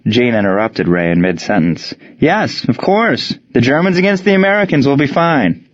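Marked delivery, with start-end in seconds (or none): 2.2-5.6 eager interruption. A narrator's reading. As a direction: cut in warmly, drawing out.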